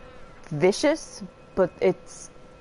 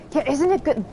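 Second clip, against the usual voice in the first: delighted; 7 semitones higher than usual. Real, not acted. Delighted by a game voice.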